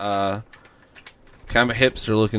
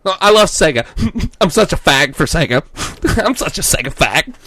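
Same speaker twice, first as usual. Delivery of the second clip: higher, louder, laughing bro voice; quoting the bro; 5 semitones higher, 3 dB louder than usual. Retold or imitated. Quoting the clerk, higher laughing bro voice. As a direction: gush about Sega, laughing. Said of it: "begin quote here".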